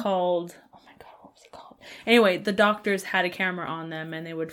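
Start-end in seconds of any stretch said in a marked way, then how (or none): 0.8-1.8 muttered whisper to self. Real, not acted. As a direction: whisper it to yourself. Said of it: "whisper heard".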